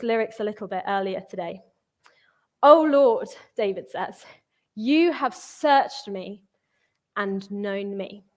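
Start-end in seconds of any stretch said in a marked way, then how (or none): 2.6-3.3 urgent cry. Acted. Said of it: "as the words of somebody who is angry and emotional". 4.7-8.2 drawn out slowly, weighed down; slow, heavy reading. Acted. Preacher reads David's psalm to the congregation, slow and heavy. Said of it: "angry and emotional and spiraling".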